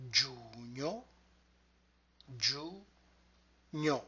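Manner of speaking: "giugno" stretched softly into giu and gno; syllable by syllable. Shown as a demonstration. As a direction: pull "giugno" apart, giu, then gno. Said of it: "will break down".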